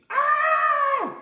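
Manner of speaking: high, loud mock scream; playful teacher scream in a high voice. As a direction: let out a pretend frustrated scream. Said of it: "he wants to scream".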